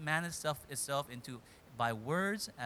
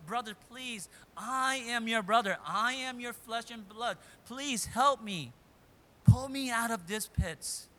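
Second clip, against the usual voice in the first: higher, louder begging to his brother; pleading; 5 semitones higher, 5 dB louder than usual. Retold or imitated. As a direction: beg him for mercy from the pit. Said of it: "He pleads".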